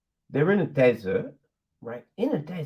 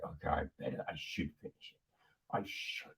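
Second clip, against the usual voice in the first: low, very soft grudging mumble; fading, half-hearted resignation; reluctant self-talk; 12 semitones lower, 16 dB quieter than usual. Retold or imitated. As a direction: talk yourself into it under your breath.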